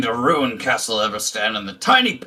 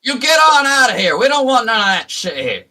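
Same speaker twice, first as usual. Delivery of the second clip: loud southern farmer shout; 12 semitones higher, 6 dB louder than usual. Acted. Farmer yells at party.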